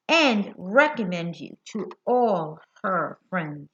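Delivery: put-on lively storybook reading to kids; reading to children. A narrator's reading. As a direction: read it to a group of little ones, bright and clear. Reads picture book aloud.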